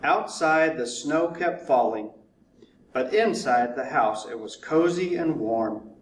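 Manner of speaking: plain reading voice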